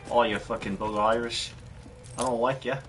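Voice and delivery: a light mock Australian accent. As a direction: tease the player in a half-hearted Aussie voice. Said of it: "I think it almost turned Australian again".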